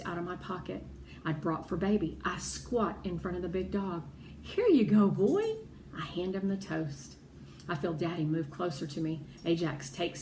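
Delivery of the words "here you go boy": 1 coaxing dog. Acted. Piper speaking to the dog.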